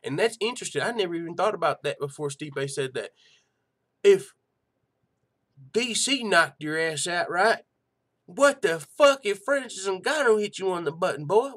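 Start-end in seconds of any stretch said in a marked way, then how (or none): 4.0-11.5 loud disbelieving rant, voice shooting up; incredulous outburst. Real, not acted. Podcaster shouting at Stipe's claim, loud incredulous outburst. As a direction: yell it like you can't believe it.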